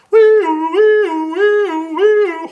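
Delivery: very high, loud siren wail; siren sound. Acted. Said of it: "it makes that alarm sound".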